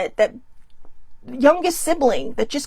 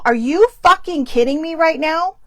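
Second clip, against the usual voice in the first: retold angry outburst; 4 semitones higher, 4 dB louder than usual. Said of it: "I was not quiet about it".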